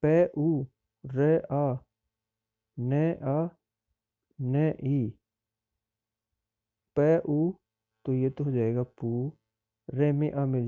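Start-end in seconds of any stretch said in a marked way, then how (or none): 0.0-7.5 प उ, र आ, न आ, न ई said letter by letter with pauses; teacher spelling the word sound by sound. Shown as a demonstration. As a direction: spell the syllables out one at a time. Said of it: "अगला है".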